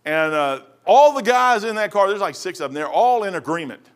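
usual speaking voice

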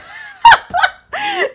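shrieking burst of laughter; high cackling laugh; loud high laugh. Real, not acted. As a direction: laugh out hard. Laughing at own story.

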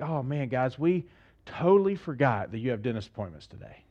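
low, soft pretend regret; feigned apology. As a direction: break fake bad news to the kids quietly. Parents feigning forgetfulness to surprise kids.

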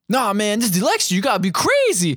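high, over-enthusiastic goofball; Chris Farley. Retold or imitated. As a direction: imitate a manic campaigner who won't calm down. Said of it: "Chris Farley is way too enthusiastic".

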